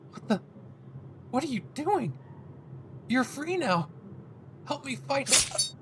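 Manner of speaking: stunned hero losing strength; confused Deku. Acted. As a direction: stammer in disbelief. Deku voiced confused, fading.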